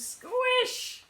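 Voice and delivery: high, squeaky squish; comic high squelch sound; squish noise. Acted. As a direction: make the squelch of stepping in it. Pete steps in strawberries.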